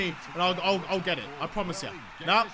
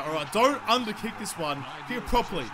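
ordinary talk, nothing marked

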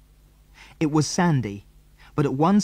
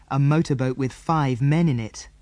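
plain reading voice

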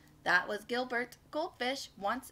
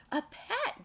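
high, thrilled exclamation; high-pitched storybook delight; delighted fish; 9 semitones higher than usual. A narrator's reading. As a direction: squeal it as a little fish overjoyed. Fish thrilled with new creature.

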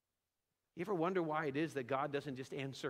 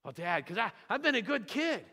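put-on rising high kid's voice, a bit louder; pleading child; 5 semitones higher, 4 dB louder than usual. Son arguing with dad that he deserves ice cream, high and pleading. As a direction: make the case like a kid sure he's earned it.

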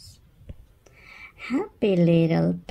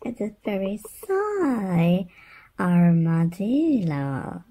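ordinary delivery

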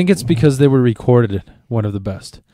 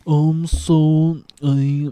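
drawn-out, halting drummer impression; 3 semitones higher, much slower than usual. Shown as a demonstration. Asked about Dutch, imitates Metallica's drummer, drawling impression. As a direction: stretch every word in a put-on voice. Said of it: "do that impression before".

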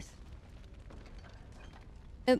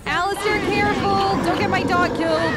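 high, loud urgent call; shouted order; 5 semitones higher, 6 dB louder than usual. Real, not acted. Player shouting at Alistair to protect the dog, loud and high. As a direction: yell a warning to Alistair.

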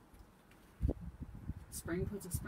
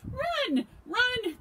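high, very loud shouts; panicked cry; 14 dB louder than usual. A narrator's reading. Hedgehog sees the pond monster, panicked shout. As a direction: yell a warning to flee. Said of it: "cried Hedgehog".

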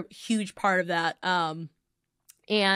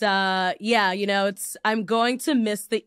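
usual speaking voice

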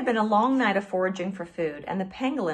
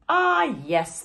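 higher, self-satisfied sigh; smug sigh; 6 semitones higher, 4 dB louder than usual. A narrator's reading. Sugar glider accepting praise, smug sigh. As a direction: preen while sighing. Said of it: "the animal sighed".

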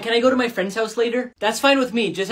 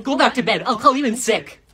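normal talking voice

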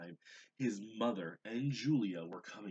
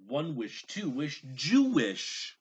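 high, loud magic incantation; exclaimed; 6 semitones higher, 11 dB louder, slower than usual. A narrator's reading. Gabriel casting his spell in the kitchen, loud high incantation. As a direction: cast the spell with full showy force. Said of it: "he exclaimed".

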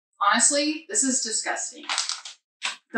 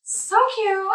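high gushing; 11 semitones higher than usual. Real, not acted. Gushing over cookie.

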